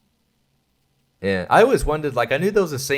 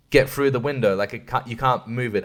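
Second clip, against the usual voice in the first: ordinary delivery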